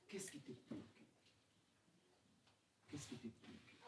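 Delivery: soft whisper, drawn in close; hushed sermon whisper; whispered question. Acted. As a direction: whisper it to each listener. Preacher drops to a whisper.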